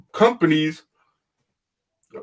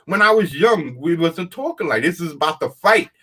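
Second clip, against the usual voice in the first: ordinary delivery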